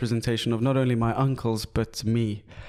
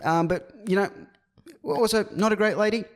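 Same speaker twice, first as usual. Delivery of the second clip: put-on higher New Zealand accent, held through; mock New Zealand accent, higher; 6 semitones higher than usual. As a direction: voice a cheeky Kiwi guest. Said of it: "I'm actually Maori".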